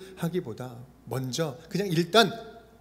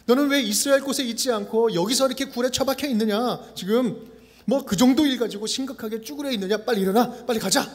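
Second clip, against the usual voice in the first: higher, pushy scolding; scolding rebuke; 5 semitones higher, 4 dB louder than usual. Pretend. An impatient God scolding Elijah to get up, harsh scolding. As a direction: chew him out and hurry him along. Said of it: "질책과 책망처럼 느껴집니다".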